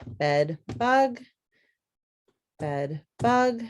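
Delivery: "Bed bug" in two even beats, said twice; syllable tapping. Shown as a demonstration. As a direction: tap out "bed" and "bug" evenly. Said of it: "Let's tap it out".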